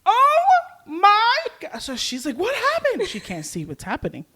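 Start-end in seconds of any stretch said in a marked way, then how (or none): 0.0-1.8 high, loud, drawn-out shriek; screaming. Retold or imitated. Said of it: "I just start screaming". 2.4-3.1 high-pitched startled question with a laugh underneath; alarmed question. Retold or imitated. Retells Alex's alarmed question.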